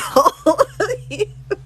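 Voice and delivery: high real laugh; loud high-pitched giggle. Real, not acted. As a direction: bursts out laughing at the comment. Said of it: "You guys are hilarious".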